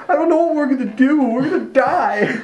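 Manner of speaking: high, whiny mock sobbing; crying Jed. Actor overplays Jed for his scene partner, hammed-up crying. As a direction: wail it in hammed-up despair. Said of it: "stop crying".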